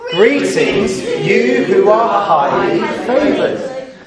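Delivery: slow, drawn-out group reading; reading aloud together. Acted. Leading the children in reading out the angel's greeting, slow and drawn-out. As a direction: read it out slowly in unison. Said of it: "can you read this out with me".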